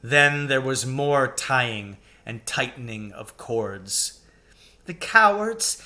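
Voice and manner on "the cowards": sobbing. A narrator's reading. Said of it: "sobbed Susan".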